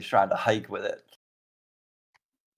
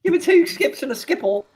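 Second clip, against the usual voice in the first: much higher, comic squeak; high squeaky voice; 14 semitones higher, 3 dB louder than usual. Acted. Character's new squeaky voice.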